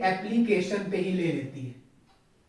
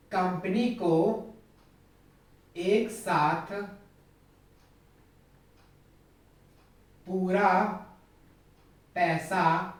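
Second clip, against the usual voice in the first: slow spaced explanation; 3 semitones lower, much slower than usual. Shown as a demonstration. Teacher spelling out lumpsum slowly.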